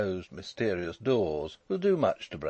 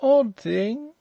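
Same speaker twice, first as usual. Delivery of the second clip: high, loud, overbearing voice; loud, high-pitched drawler; drawling toff; 8 dB louder than usual. A narrator's reading. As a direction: question him like a lordly toff. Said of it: "drawling, overbearing fellow with an eyeglass".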